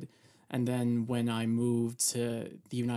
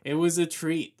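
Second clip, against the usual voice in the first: loud, high fake enthusiasm; sarcastic; 5 semitones higher, 10 dB louder than usual. Real, not acted. Guest thanked for watching a bad show, sarcastic. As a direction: praise it as if you mean the opposite. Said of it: "I'm being sarcastic".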